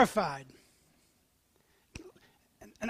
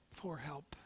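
whispered; 6 semitones lower, 17 dB quieter than usual. Acted. Sermon climax, hushed aside.

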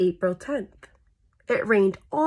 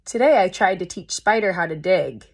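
usual speaking voice

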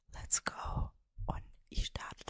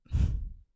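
whispered laugh; 11 dB louder than usual. Real, not acted.

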